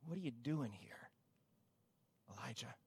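God's question, spoken very softly; hushed question. Acted. God asking Elijah in the cave, very soft and hushed. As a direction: ask it as the still small voice.

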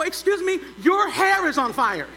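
high, loud, slowed-down warning; loud warning to the lady whose hair burns; yelled warning. Retold or imitated. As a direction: shout politely but urgently, with a laugh. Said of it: "And so finally I yelled".

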